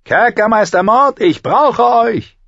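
loud, high, slow drawn-out call; shouting. A narrator's reading. Tyrion calls the jailer from his cell, shouting. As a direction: bellow so the jailer hears you. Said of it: "Gut zehn Minuten musste er rufen".

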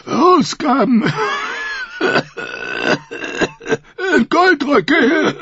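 gasping between coughs; wheezing. A narrator's reading. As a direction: wheeze the words out. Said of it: "Lord Giles keuchte".

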